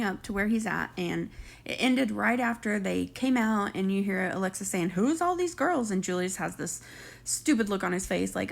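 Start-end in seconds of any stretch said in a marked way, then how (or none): 4.9-5.9 higher, slow imitation of a suspicious girlfriend; mock confrontation. Girlfriend pulling up on her man, mock confrontational. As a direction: draw it out like a challenge.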